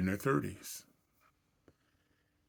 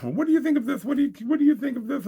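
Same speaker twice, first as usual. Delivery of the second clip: high, louder, quickening girlfriend imitation; girlfriend's voice; 8 dB louder, faster than usual. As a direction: mimic her pushing the question again and again, faster.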